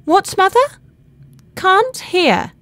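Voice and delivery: loud, high-pitched questioning call; calling upstairs. A narrator's reading. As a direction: call up to your mother, straining to be heard. Laura calls up to mother.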